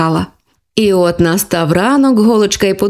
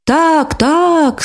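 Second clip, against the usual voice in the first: excited toys; 7 semitones higher than usual. Acted. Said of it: "схвильовано загули іграшки".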